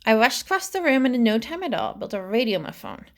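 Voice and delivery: ordinary delivery, nothing marked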